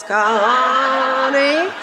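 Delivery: imitating a Georgia accent, syllables pulled long; long, lilting Southern call of a name; drawn-out Southern accent. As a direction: stretch the name in a slow Southern drawl, rising. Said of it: "heard my name in eight syllables".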